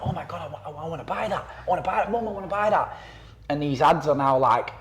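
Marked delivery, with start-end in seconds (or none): 0.0-3.0 put-on higher, pleading kid wanting a toy; mock kid begging. Kid in front of the TV begging mum, mock whiny child. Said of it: "you go into the T V like".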